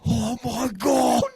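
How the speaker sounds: high, drawn-out mock horror; hammed-up slow gasp of disbelief; shocked reaction. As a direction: imitate an aghast family, stretching each word. Mum and son react, shocked.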